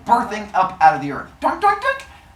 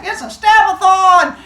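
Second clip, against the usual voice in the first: gleeful cheer; 8 dB louder than usual. Acted. Father Sky cheering stabbing game.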